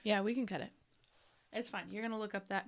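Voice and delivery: normal talking voice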